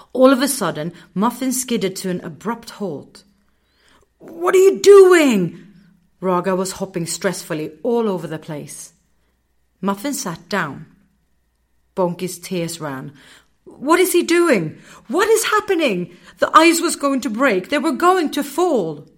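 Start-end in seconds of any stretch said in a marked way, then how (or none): 4.2-5.6 slow, high, loud alarmed cry; shocked he stopped; frightened shrieking question; panicked cry. A narrator's reading. Panic as Muffin halts. 13.6-19.1 panic. A narrator's reading. Bonky panicking, tears running.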